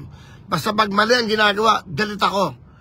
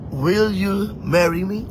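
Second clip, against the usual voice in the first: mock proposal; much slower than usual. Acts proposal again.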